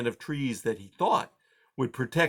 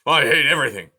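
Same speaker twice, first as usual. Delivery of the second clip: grumpy porcupine; 3 semitones higher, 9 dB louder, faster than usual. A narrator's reading. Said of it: "he muttered".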